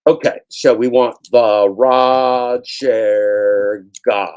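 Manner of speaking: loud, slow, drawn-out announcing; booming slow announcement; loud announcer call. Real, not acted. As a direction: belt it out like a ring announcer.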